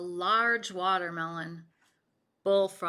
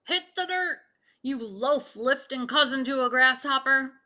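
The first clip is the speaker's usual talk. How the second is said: loud, high shouted command; barked order; 3 semitones higher, 7 dB louder than usual. Acted. Baker shouting at Bullfrog, loud. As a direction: order him down. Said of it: "he heard the order".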